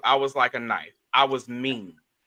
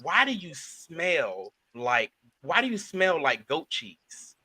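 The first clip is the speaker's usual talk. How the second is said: slow question; slower than usual. Retold or imitated. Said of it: "I asked him".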